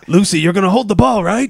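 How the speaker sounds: high, gruff tough-guy voice; menacing Pacino as Charlie Brown; Al Pacino impression. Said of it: "Al Pacino played Charlie Brown".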